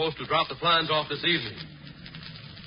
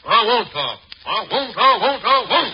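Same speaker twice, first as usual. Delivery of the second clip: stubborn loud refusal; 5 semitones higher, 7 dB louder than usual. Acted. Refusing under threat.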